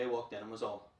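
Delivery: usual speaking voice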